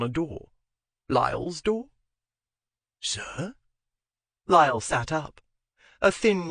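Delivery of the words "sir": quiet, softer call; soft query. Acted. Valet quietly asking his master, soft. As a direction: ask gently in the dark. Valet speaks softly in dark.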